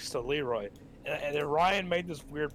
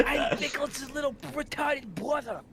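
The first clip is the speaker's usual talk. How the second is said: high character voice; 10 semitones higher than usual. Pretend. Playing a character voice.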